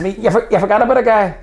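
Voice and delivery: high and loud, pressing the point; indignant retort. Retold or imitated. Student corrects the English lecturer mid-lecture, loud and high. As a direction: cut in hard with the correction.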